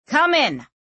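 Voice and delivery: high, loud bark; barked order. A narrator's reading. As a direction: snap the command out. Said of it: "he sighed and barked".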